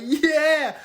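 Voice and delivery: loud, high, drawn-out yeah; boastful mock shout. Hush Puppy answering his admirers, boastful shout. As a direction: belt it out, revelling in the hype.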